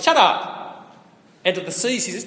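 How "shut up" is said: high, loud rebuke to the sea; stern command. Retold or imitated. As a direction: bark it at the waves. Jesus rebukes the waves.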